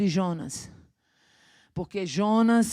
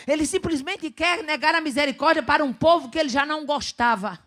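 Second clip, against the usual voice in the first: higher pitched pulpit insistence; rising sermon emphasis; 7 semitones higher, 5 dB louder than usual. Acted. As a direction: press the point on the congregation. Preacher raising pitch on point.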